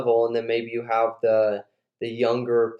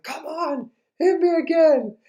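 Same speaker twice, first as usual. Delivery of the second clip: very high, strained taunt; retold challenge in a pushed-up voice; goading dad. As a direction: dare him to swing. Dad goads son to hit.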